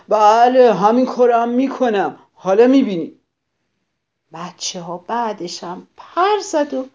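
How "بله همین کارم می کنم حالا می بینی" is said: loud, higher defiant yell; angry shout. Acted. Grouchy ladybug shouts a threat at the friendly ladybug. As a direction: shout a threat as you storm off. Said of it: "فریاد زد".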